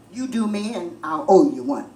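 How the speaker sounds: a low, loud mock-male voice; mock low man. Boyfriend's crude offer in a low mock-male voice. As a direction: play him smug and crude.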